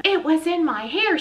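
loud, high outburst; shouting. A narrator's reading. Rita shouts that it was in her hair. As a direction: shout it out in shock. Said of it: "she shouted".

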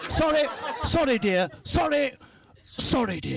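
high, jerky repeated apologies; apologising with every thrust. Acted. Englishman apologises to his dear during sex, high yelps. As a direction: yelp a polite apology on each thrust. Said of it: "Apologising with every thrust".

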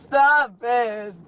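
loud desperate cry to stop; real frightened shout. Real, not acted. Girlfriend yelling at him to stop threatening. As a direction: yell at him to stop, close to tears.

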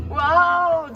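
usual speaking voice

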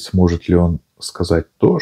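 plain reading voice

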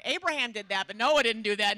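normal talking voice

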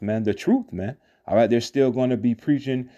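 ordinary talk

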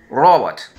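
"Robot" said the English way, stress on first syllable "RO-bot". Shown as a demonstration. Teacher modeling English "Robot" for kids, stressed first syllable. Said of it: "Sencillo, escucha bien".

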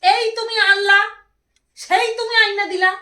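loud, high-pitched complaint at husband; loud complaining. Real, not acted. Wife scolds husband for late gifts, loud complaint. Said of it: "আস্তে".

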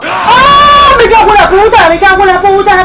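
high, loud frightened swearing; panicked yelling. Real, not acted. Player panicking after a scare, yelling curses. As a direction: scream the curses out in real fright.

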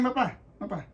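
ordinary talk

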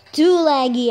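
loud frustrated complaint; frustrated yell. Real, not acted. Gamer complaining to viewers about lag, loud frustrated yell. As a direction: bark it out at the lag.

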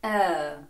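«е» held with relaxed lips, slight smile; long «е» as in girl; relaxed-lip vowel. Shown as a demonstration. Teacher models the vowel in girl, relaxed long vowel. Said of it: "як в слові girl".

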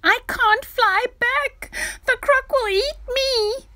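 put-on high, loud, wailing child's voice; panicked cry. A narrator's reading. Dudu trapped in a dream with the crocodile, wailing in fear. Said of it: "cried Dudu".

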